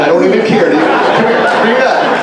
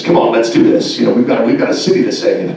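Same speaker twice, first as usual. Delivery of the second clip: low heroic superhero voice; deep superhero voice; 7 semitones lower than usual. Shown as a demonstration. Demo of the generic superhero, low and heroic. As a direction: growl it like a square-jawed hero. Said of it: "your voice gets low".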